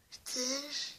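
quiet rising dare; hushed mock dare; soft taunt. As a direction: ask it softly, almost kindly. Teddy taunting viewer.

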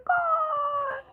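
very high, soft squeal of a goal; squeaky goal. Real, not acted. Gamer celebrating a goal, high soft squeal. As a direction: squeak it out in delight.

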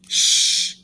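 urgent hissing shush; loud shush. Acted. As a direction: shush them right away. Hushing after sneeze.

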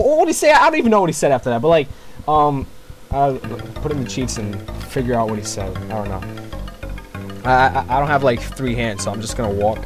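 ordinary talk, nothing marked

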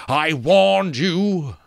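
high, drawn-out skull warning; slow, loud, regretful skull; sad warning. A narrator's reading. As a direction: stretch it out regretfully. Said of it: "the skull said sadly".

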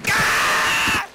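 death cry. Shown as a demonstration. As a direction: show off the death yell again. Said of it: "That was me dying, my death scream".

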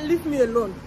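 ordinary talk

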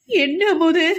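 high, loud broken cry; the question collapses into a wail; anguished cry; breaking down wailing. A narrator's reading. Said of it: "உடைந்து கதறினான்".

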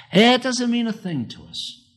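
high, ringing emphatic statement. Acted. Preacher stressing to his church that the verse means nothing. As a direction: throw the line up high to the congregation. Repeated line, first take high.

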